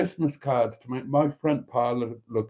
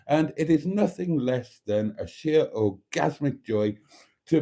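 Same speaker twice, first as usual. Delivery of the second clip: campy drag queen. Acted. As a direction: gush over the audience theatrically. Camp show host greeting.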